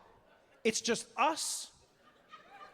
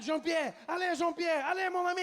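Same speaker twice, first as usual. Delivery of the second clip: high, hammed-up French panic with stretched endings; panicked Frenchman; 8 semitones higher than usual. French father fleeing a snake, mock panicked French shout. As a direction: cry out as a mock-terrified French dad. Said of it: "The French guy was my favorite".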